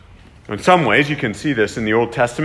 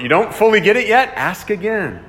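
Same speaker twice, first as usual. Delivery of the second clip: urging ask again; 8 semitones higher, 4 dB louder than usual. Retold or imitated. Preacher voices Jesus urging.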